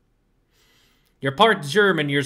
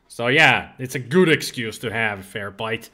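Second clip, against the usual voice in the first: normal talking voice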